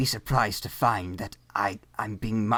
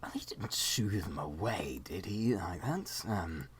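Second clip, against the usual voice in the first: unvoiced whisper; 5 semitones lower, 11 dB quieter than usual. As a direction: whisper like sneaking around. Whispered sneaking bit.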